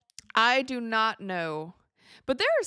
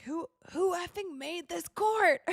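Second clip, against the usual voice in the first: mock outraged player; 9 semitones higher than usual. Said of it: "He's like".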